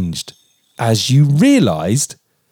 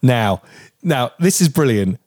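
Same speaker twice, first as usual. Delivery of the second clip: usual speaking voice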